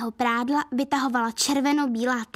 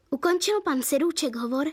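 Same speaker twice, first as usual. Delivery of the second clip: plain reading voice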